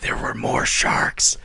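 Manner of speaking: slow ominous whisper; a slow, spooky whisper to a kid. Pretend. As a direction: whisper it slowly like a ghost story. Continuing the whispered scare joke.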